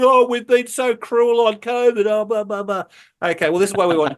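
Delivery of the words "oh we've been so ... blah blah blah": mock crying king. Host mocking the Swedish king's tearful apology, hammed-up sobbing. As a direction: whine it like a king faking tears. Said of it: "The king cried".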